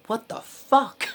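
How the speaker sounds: high, loud, drawn-out disbelief; retold amazement. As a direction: stretch it out in squealed astonishment. Retold amazement at recognizing actor.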